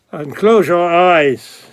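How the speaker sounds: usual speaking voice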